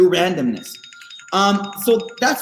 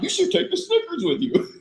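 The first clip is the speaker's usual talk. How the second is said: higher, softer ad-like suggestion; 8 semitones higher, 5 dB quieter than usual. Retold or imitated. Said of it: "I literally said".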